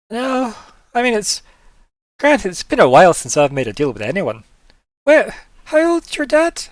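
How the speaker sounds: high-pitched, animated character voice; NPC in character. Acted. NPC asks how old your dad is, high character voice. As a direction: play a lively character, pitched well up and loud.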